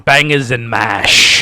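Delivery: loud, slow mock British; hammed-up British; British accent. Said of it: "quoting British things".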